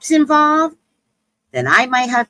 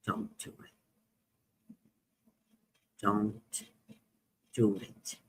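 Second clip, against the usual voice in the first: near-whisper, much softer, slow; hushed warning; 12 semitones lower, 18 dB quieter, slower than usual. Retold or imitated. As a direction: warn her under your breath.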